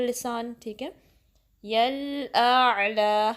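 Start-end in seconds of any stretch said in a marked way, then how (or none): 1.6-3.3 यल आला drawn out very slowly, the लाम held steady with no wobble; slow, steady recitation; steady lam. Shown as a demonstration. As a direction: keep the लाम in यल आला still and long. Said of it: "लाम को हिलाना नहीं है".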